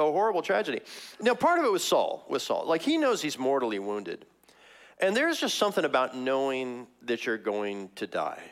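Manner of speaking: ordinary delivery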